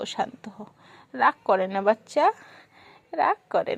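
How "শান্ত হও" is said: dropped low and very soft; soft hush. Real, not acted. Mother calming her angry baby, in a soft hush. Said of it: "আমার বাচ্চা শান্ত হও".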